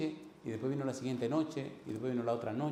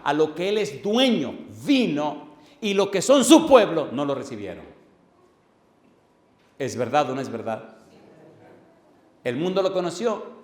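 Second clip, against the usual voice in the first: full-force preaching; 4 semitones higher, 9 dB louder than usual. Acted. Pastor preaching John to the congregation, loud and forceful. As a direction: thunder the verses at the church.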